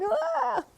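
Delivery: sudden high shriek of alarm; mock startled scream. Men in the elevator seeing her, a high startled shriek. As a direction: yelp like startled men in suits. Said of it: "men are going".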